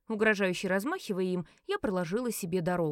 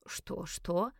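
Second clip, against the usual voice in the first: puffing; 4 dB quieter than usual. A narrator's reading. Red-faced fat gentleman caught by surprise, puffing. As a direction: puff it out softly, like a winded fat man. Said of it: "пропыхтел он".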